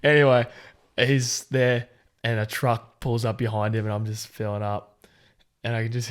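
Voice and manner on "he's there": ordinary talk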